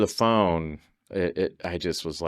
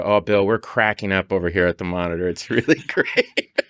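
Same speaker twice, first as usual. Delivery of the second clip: imitating laughing director; 7 dB louder than usual. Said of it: "You go".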